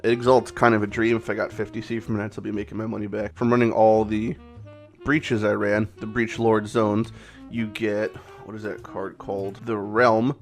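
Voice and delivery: usual speaking voice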